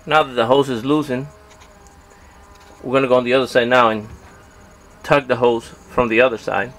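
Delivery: normal talking voice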